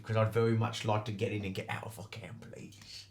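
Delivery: fast whispered aside. Acted. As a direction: hurry it out in a whisper. [name]'s quick aside.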